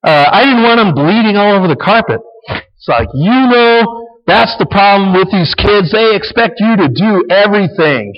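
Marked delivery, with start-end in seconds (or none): 0.0-2.2 mock wife, higher and a bit louder; rescuing wife. Wife defending the bleeding child, lightly acted. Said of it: "now she's back to rescuer". 3.1-8.1 mock husband, pitched higher; blaming husband. Husband blaming wife for rescuing, mock exasperated. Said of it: "She blames the rescuer".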